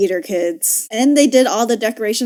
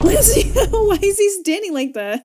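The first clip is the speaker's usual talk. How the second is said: incredulous laugh; 9 semitones higher than usual. Real, not acted.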